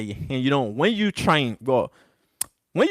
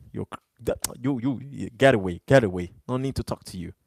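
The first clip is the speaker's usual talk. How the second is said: dismissive mutter; 5 semitones lower, 6 dB quieter than usual. Real, not acted. Brushing off troll.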